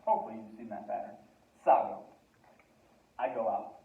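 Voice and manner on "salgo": Salgo punched loud with a falling end; stressed salgo. Shown as a demonstration. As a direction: hit Salgo hard and drop at the end. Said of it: "the yo form is where it changes".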